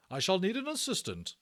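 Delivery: high, loud, brisk command; brisk matron. Acted. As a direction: state it briskly like a head nurse. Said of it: "she said briskly".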